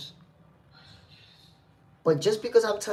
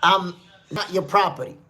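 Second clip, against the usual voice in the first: usual speaking voice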